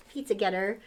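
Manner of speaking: ordinary delivery, nothing marked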